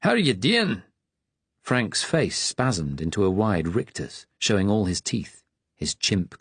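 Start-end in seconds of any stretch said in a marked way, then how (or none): 0.0-0.8 Scottish brogue, friendly query; broad Scottish accent. Acted. Attendant greeting a patient, broad Scottish accent. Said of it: "in a broad Scottish accent".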